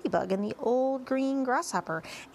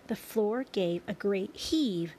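usual speaking voice

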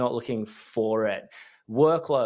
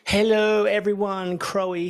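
big hello; 7 semitones higher, 3 dB louder than usual. Real, not acted. Loud cheerful show opener.

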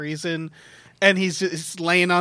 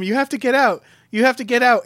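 raised, higher mock yell; retold scream; 6 semitones higher, 4 dB louder than usual. Crew screaming at him to get out, mock yelling. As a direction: urgently call out, imitating movie screaming. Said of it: "they start screaming at him".

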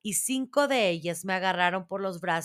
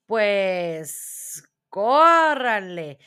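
loud, long-drawn command; witch's shriek; 5 dB louder, much slower than usual. A narrator's reading. Grand Witch ordering her witches from the platform, shrieking. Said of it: "chilló la gran bruja".

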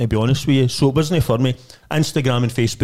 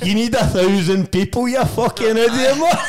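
mocking heckler; 7 semitones higher than usual. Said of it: "Next minute, I get slaughtered".

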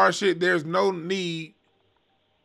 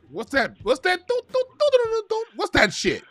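squeaky imitation of melody, mocking; jeering outsider hums a tune; mock critic; 12 semitones higher than usual. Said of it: "the Chinese folks and the Japanese folks and be like".

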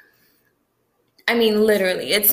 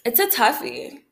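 usual speaking voice

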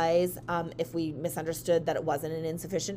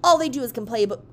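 raised, louder mock rant; losing it; 5 semitones higher, 5 dB louder than usual. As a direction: imitate the customer ranting. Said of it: "she starts just losing it".

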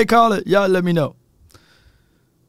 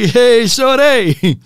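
mock catcall; 7 semitones higher, 4 dB louder, much slower than usual. Acts out Donald catcalling.

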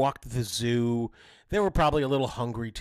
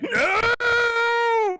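very high, loud drawn-out mock scream; freaking out; 7 dB louder than usual. Daughter flees brother's kiss, hammed-up freak-out. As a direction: imitate a kid shrieking in panic. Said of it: "She's freaking out like".

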